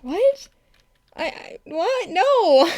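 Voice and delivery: high, stammering mock disbelief; stammer, then a loud high protest; flustered denial. Denying the British accent to viewers, flustered mock disbelief. Acts out own stunned denial.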